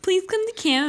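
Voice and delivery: high pleading voice; mock pleading. Quoting a contestant's plea.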